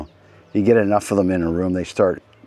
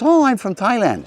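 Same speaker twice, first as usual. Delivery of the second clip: mock stewardess, delighted; 12 semitones higher than usual. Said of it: "She goes".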